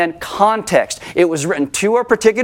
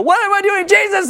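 much higher, frantic plea; panicked cry. Pretend. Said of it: "Out of control".

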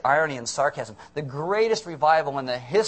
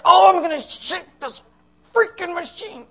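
high, loud mock-furious outburst; hammed-up anger; 7 dB louder than usual. Customer robbed by a Coke machine, hammed-up fury. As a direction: strain with comic rage at a machine. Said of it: "and you're angry".

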